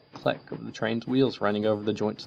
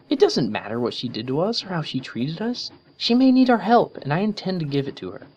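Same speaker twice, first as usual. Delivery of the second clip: determined Twilight; 9 semitones higher, 4 dB louder than usual. A narrator's reading. Twilight insists on helping.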